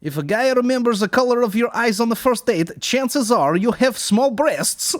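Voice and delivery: high, loud mock Russian accent. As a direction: play a Russian joke teller. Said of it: "a Russian accent".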